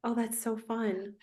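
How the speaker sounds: usual speaking voice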